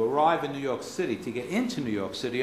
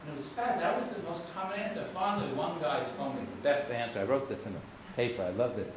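ordinary talk, nothing marked

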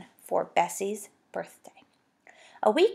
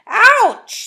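high, loud startled yelp; startled ouch; 13 semitones higher, 11 dB louder than usual. Acted. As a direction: jump at the bump.

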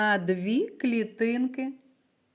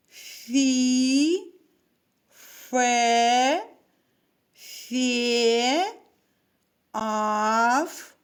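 each syllable said slowly and clearly, with a pause after each; syllable drill; 3 dB louder, much slower than usual. Shown as a demonstration. Teacher drilling syllables with Ф for the class, clear and spaced. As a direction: read the syllables one by one for the children to echo. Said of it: "Прочитаємо разом склади з літерою «Ф»".

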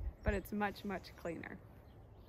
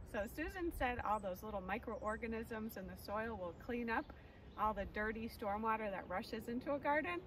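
usual speaking voice

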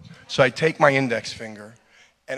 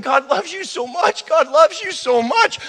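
much higher, rising tender pleading; tender crying; 13 semitones higher than usual. Retold or imitated. Preacher retelling comforting a dying bird, high tender cry. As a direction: pour love over the bird, voice climbing high. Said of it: "as I'm saying".

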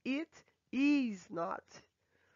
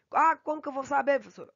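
quick, louder mock student query; lightly voiced student, higher and faster; imitated student question; 5 semitones higher, 7 dB louder, faster than usual. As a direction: play a puzzled student asking teacher.

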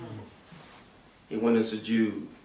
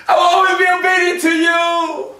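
high, loud, joyful vow to God; the soul in paradise calling out its love to Allah, ecstatic; ecstatic devotion; 8 semitones higher, 3 dB louder than usual. Acted. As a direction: pour out love with a laugh in it. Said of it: "It was".

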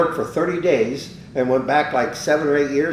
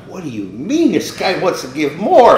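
higher and louder, amazed and half laughing; he can't believe his luck; excited disbelief; 6 semitones higher, 8 dB louder than usual. Retold or imitated. Disbelief at the generous offer.

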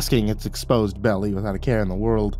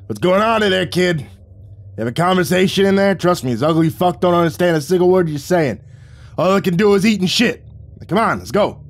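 high, loud holler over the intercom; 8 semitones higher, 3 dB louder than usual. Acted. Boss hollers over the intercom at the kid, loud. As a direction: yell at the kid to wrap it up. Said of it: "Vic turned on the intercom and hollered".

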